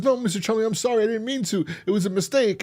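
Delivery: high, pleading mock apology; panicked apology. Acts out panicked apology to landlord.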